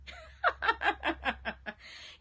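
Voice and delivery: long, high laugh tailing down; real rolling high giggle; self-amused laugh. Real, not acted. Laughs at her accent coming out.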